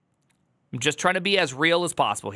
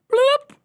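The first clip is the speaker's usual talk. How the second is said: sudden high loud bloop; popping exit noise; 8 dB louder than usual. Pretend. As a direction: pop out like a bubble. Mimics chatter leaving abruptly.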